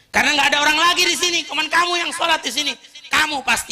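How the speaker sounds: high, loud, fast accusation; accusing shout. Retold or imitated. Man accusing Ali of stealing his money, shouting. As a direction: hurl the blame at him fast and loud.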